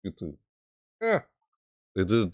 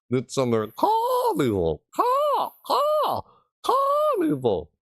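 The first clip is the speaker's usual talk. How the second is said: very high, drawn-out cawing on "Car"; crow caw; slower than usual. Acted. Streamer making the crow pun, cawing. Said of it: "because they're crows".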